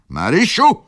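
high, loud scolding cry; sharp shout. A narrator's reading. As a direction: shout the girl's name sharply. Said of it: "krzyknęła baronowa". (ostry, wysoki krzyk)

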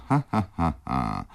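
acted falling "ha-ha" peal; mocking laugh. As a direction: laugh mockingly at your own joke. Widow laughs at her own joke. (zagrany kpiący śmiech)

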